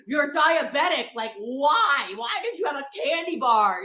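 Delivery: loud, incredulous telling-off; exasperated scolding. Retold or imitated. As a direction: demand an explanation, imitating the scolder. Retold scolding of the diabetic hiker.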